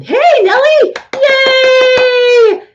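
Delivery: loud, high-pitched cheer; excited greeting. Real, not acted. Host greeting Nellie as she joins the chat, excited cheer. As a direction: call out in delight, stretching the yay.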